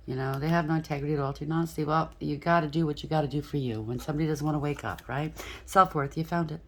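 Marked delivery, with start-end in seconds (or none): none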